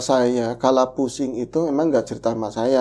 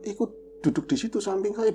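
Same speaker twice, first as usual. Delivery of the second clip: ordinary talk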